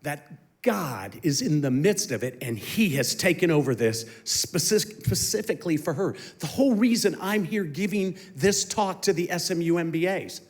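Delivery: ordinary talk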